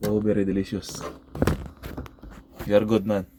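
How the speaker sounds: ordinary delivery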